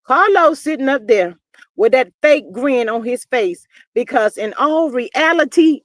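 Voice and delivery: normal talking voice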